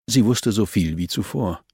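ordinary delivery, nothing marked

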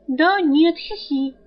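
put-on coy, simpering society girl; simpering lady. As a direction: play the empty-headed lady. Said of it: "как Альк научил".

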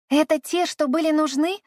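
put-on eager child can't wait; impatient. A narrator's reading. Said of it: "Нетерпеливо поинтересовалась Эллен". (нетерпеливо, выше и громче)

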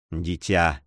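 low, gentle endearment; soothing low voice; tender. A narrator's reading. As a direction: say it fondly, dropping the pitch. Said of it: "сказал он с нежностью".

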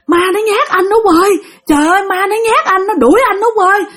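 high, panicky whine of terror; frightened wailing. Acted. Father tells his wife a ghost scared him, high panicked wail. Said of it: "Là ba la vậy đó".

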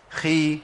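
خي with breath running through the خ; خاء مكسورة مهموسة; kha with kasra. Shown as a demonstration. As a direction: say خي with audible air on the خ. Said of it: "الخاء المتحركه بكسر".

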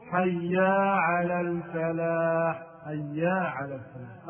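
chanted call with حي as "hayyaa"; added alif. Shown as a demonstration. Teacher mimicking a mistake, حي lengthened to "hayyaa". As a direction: intone حي with a long a added at its end. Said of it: "زيادة ألف في حي".

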